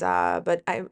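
ordinary talk

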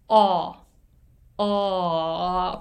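"O" held open and steady, mouth not moving; demo vowel of ball. Shown as a demonstration. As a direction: say "O" as an open aw with no glide. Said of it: "投げるボールの方は、Oっていう母音". (「O」を口を動かさず開いたまま)

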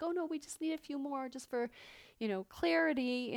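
mock-reassuring technician; mimicked reassurance. Mimicking technicians' usual brushed-off excuse.

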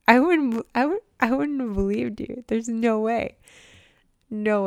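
high, loud, amazed denial; almost laughing, no way; excited disbelief. Real, not acted. Telling viewers how unbelievable her new life is, excited.

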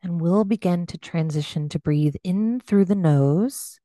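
unhurried meditation voice with long pauses; gently pace them through breath and body, leaving space; guided meditation. Acted. Leads a body scan meditation.

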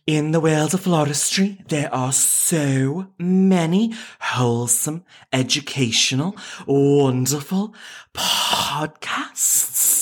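slow, drawn-out Scouse announcer; exaggerated Scouse with long, lingering words; Scouse accent. Host starting the floristry podcast intro from the top, Scouse accent. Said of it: "whole intro just fully in Scouse".

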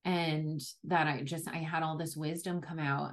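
ordinary delivery

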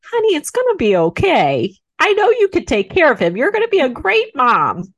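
imitated gushing reassurance, pitched well up; mother-in-law reassuring. Mother-in-law consoling a new mom crying in her wheelchair, imitated reassurance.

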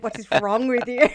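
imitating the kids while giggling; laughing. Host imagining her kids' reaction, giggling mock question. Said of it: "they're just gonna look at me like".

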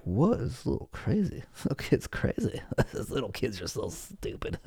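soft, laughing aside; amused disbelief. Retold or imitated. Adult watching a kid's meltdown, quiet laughing scoff. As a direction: snicker it under your breath. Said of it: "you're like".

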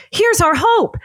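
a rich lady spotting her rescue and crying out in delight; excited exclaim. Acted. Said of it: "Missus Bond Regent exclaims".

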